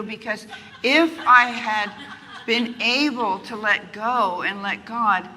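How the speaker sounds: laughing exasperation. Real, not acted. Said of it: "it drives me crazy".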